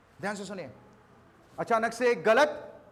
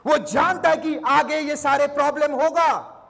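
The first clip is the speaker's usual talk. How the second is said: louder, punched sermon line; preaching emphasis; 5 semitones higher, 8 dB louder than usual. Acted. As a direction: drive the point home to the crowd.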